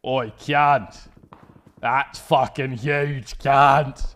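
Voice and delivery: mock the way the world thinks Australians talk. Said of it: "that's how all of the world thinks Australians talk".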